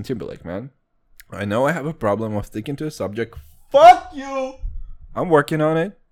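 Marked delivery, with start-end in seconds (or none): 3.7-4.5 sudden loud, high yell; mock angry outburst. Defending his wandering off the subject, mock angry shout. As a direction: burst out at full volume as a joke. Mock snapping back at criticism.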